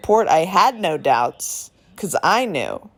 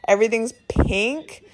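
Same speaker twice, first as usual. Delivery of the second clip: usual speaking voice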